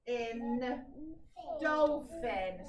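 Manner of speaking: sounded out slowly, syllable by syllable; drawn-out phonics blending; split into syllables. Shown as a demonstration. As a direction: break dolphin into dol and phin, then blend. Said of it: "So I split that".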